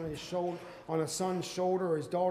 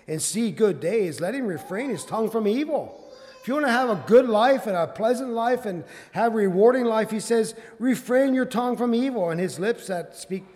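loud, raised scripture preaching; forceful preaching; 6 semitones higher, 12 dB louder than usual. Real, not acted. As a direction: drive the verse home to the congregation.

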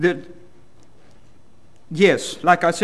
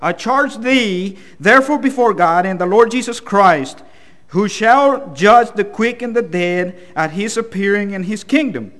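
raised, forceful pulpit reading; emphatic scripture reading; 5 semitones higher, 5 dB louder than usual. Acted. Reads scripture aloud to congregation.

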